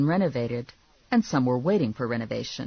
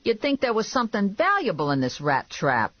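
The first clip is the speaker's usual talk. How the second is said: higher-pitched, complaining man; griping bounty-office boss; 7 semitones higher than usual. A narrator's reading. Man mocking her many door locks, griping. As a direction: gripe in a raised character voice. Narrator voices Vinny.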